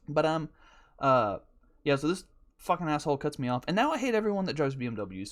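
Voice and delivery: usual speaking voice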